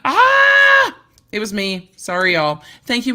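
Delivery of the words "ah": high, loud startled shriek of panic; panicked scream. Retold or imitated. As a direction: let out a sudden loud yelp of panic. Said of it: "I was like".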